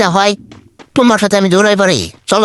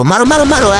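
excited shout; 6 semitones higher, faster than usual. Real, not acted. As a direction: yell it out as the enemy goes down. Excited fight, urging to kill. (উত্তেজিত চিৎকার)

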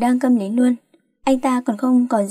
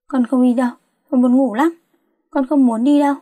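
mildly whining, sleepy protest; petulant plea to be left in bed; whiny refusal; 3 dB louder than usual. A narrator's reading. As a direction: dig in like a pestered daughter. Sleepy daughter refuses to get up.

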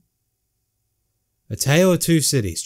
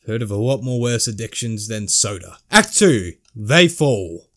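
ordinary delivery

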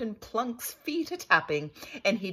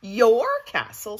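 louder, higher, indignant "Your castle?"; indignant question; 6 semitones higher, 9 dB louder than usual. Acted. Pearl challenges the count over the castle, indignant. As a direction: throw his words back at him.

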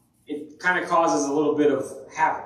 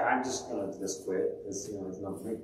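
defeated quitting; 10 semitones lower, 11 dB quieter than usual. Retold or imitated. Quitting after ten years.

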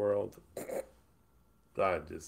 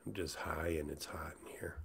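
whisper; 4 semitones lower, 9 dB quieter than usual. Real, not acted. Whispered confession.

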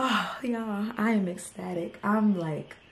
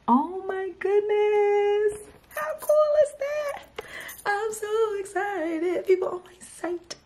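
high, slow, thrilled disbelief; can't believe it's happening, squeal it out; overjoyed squeal; 11 semitones higher, slower than usual. Real, not acted. First orders coming in live.

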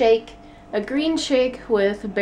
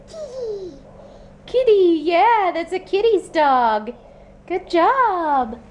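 put-on high sing-song baby talk; talking to a toddler; 7 semitones higher, slower than usual. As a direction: coo at the toddler, high and bright.

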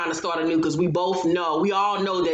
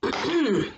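clearing throat; 8 semitones higher than usual. Real, not acted. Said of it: "let me take a sip of water".

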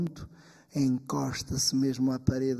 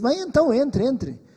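grumpy; 9 semitones higher, 6 dB louder than usual. Acted. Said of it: "Mal-humorado".

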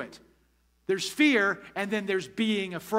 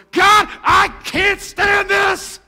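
venting prayer; 9 semitones higher, 11 dB louder than usual. Pretend. Pastor acting out a believer venting to God alone, furious shouting. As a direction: pour it all out at God at full voice, nothing held back. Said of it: "get alone before God and go".

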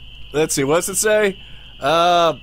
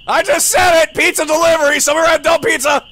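loud, higher exasperated call; annoyed calling out; 9 semitones higher, 4 dB louder than usual. Acted. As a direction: yell it again, fed up.

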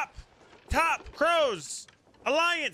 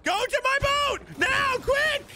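shouting; 8 semitones higher than usual. Real, not acted. Urging teammate onto his boat.

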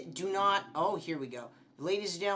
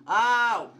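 loud, higher "ow"; startled yelp; 7 semitones higher, 11 dB louder than usual. Real, not acted. Player hit by a game scare, startled yelp. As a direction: yelp out in shock.

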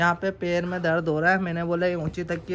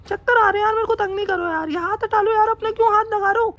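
mimicking wife; 12 semitones higher than usual. Husband mimics his wife to the camera, high falsetto. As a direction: squeak it out like a pouty wife. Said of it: "mimicry कर रहा हूँ मैं".

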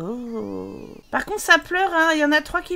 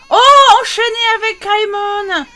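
louder, higher despairing oh; exasperated cry; 7 semitones higher, 10 dB louder than usual. Real, not acted. More babies start crying.

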